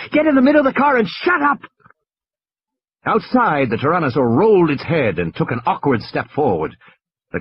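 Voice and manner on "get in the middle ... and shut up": high, fast, impatient order; snapping boy. Acted. High, fast, snapping boy voice. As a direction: Tim orders his sister to hide and hush. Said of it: "He turned to Lex".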